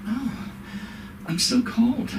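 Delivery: put-on high, plaintive old-lady whine; whiny old mother.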